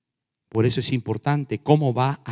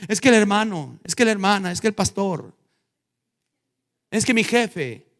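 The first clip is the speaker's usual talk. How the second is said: blaming excuses; 8 semitones higher than usual. Acted. Acts out excuse-makers.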